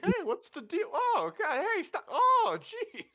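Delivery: high, loud yelps of protest while being shoved; squeaky protesting patron. Acted. Patron thrown into the street, high squeaky protests. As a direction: squeal as you're pushed out the door.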